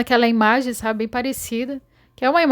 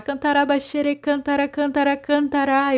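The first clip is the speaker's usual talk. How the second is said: higher, chanted tongues prayer; speaking in tongues; 5 semitones higher than usual. Retold or imitated. Dreamer demonstrating how she prayed in tongues, higher chant. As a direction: pour out syllables like ecstatic prayer. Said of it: "Eu comecei a orar".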